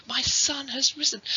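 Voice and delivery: high, elated exclamation; thrilled and amazed, pitched high; overjoyed mother. Acted. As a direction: let the joy lift your voice right up. Mary realises her son is alive.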